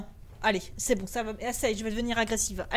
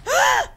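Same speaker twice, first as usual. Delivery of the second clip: startled scream; 10 dB louder than usual. Real, not acted. Jump scare in horror game.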